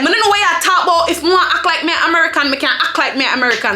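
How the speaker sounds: quick patois comeback; retold retort in quick patois; Jamaican patois. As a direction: argue back fast in Jamaican patois. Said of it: "I was arguing backward".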